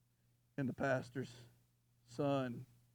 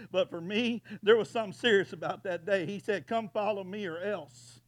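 preaching; 5 semitones higher, 6 dB louder than usual. Acted. Preaching to congregation.

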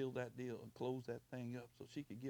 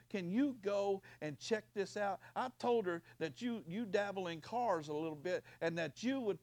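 loud, raised-pitch sermon delivery; impassioned preaching; 6 semitones higher, 11 dB louder than usual. Real, not acted. Preacher testifies about reaching out to help, impassioned. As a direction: preach it out with driving force.

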